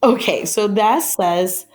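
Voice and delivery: ordinary delivery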